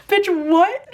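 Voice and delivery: high, loud disbelieving squeak; stunned disbelief. Retold or imitated. Retelling her reaction to the book's ending, high shocked squeak. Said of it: "I was just like".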